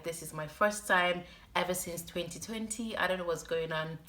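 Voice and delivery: ordinary talk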